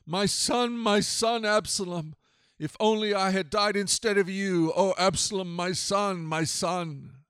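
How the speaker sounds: grieving father's cry; tearful lament. A narrator's reading. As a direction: wail it in mourning, without sobs. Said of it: "burst into tears".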